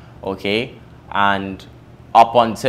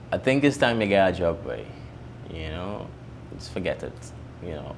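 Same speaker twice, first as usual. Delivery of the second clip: a soft, sighing, resigned mother's voice, fading out; tired mom gently telling her son to quit; weary mother; 4 semitones lower, 9 dB quieter than usual. Retold or imitated. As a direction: let the words sink as if she has given up on him.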